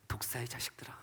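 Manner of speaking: hushed rebuke. Acted.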